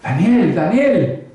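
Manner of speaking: a king calling anxiously into the lions' den. Acted. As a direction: call to Daniel below, voice lifted. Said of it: "Llamó a voces a Daniel".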